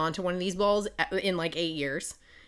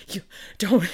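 hushed whispered plea; a whispered plea to stay; 4 dB louder than usual. Retold or imitated. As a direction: whisper it urgently so no one hears. Max pleading, unvoiced.

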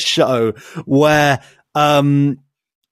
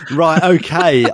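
ordinary talk, nothing marked

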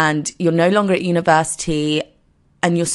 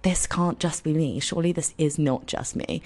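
softly voiced thought to herself; private doubt; 9 dB quieter than usual. Twenty-four-year-old wondering if she's alone, quiet private doubt. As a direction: drop much softer, as if thinking aloud.

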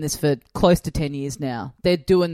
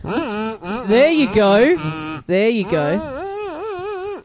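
slow, high drawn-out wah voice; muffled wah wah talk; 5 semitones higher, much slower than usual. Retold or imitated. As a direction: stretch it like a muted trombone. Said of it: "that's a good impression".

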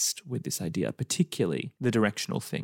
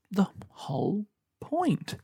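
whispered aside; 8 semitones higher, slower than usual. Real, not acted. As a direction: drop to a whisper for the punchline. Whispered punchline of disclaimer.

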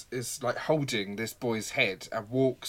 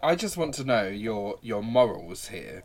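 usual speaking voice

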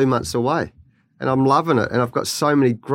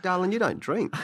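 softly quoting wife; 5 semitones higher, 7 dB quieter than usual. Retold or imitated. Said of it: "She said".

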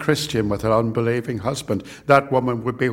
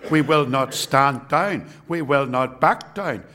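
roaring rallying speech; 4 semitones higher than usual. Acted. Rallying climax of sermon.

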